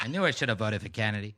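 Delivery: laughing mock Boston accent; playing up the Boston guy. Joking with friend in a mock Boston accent. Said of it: "Perfect accent, man".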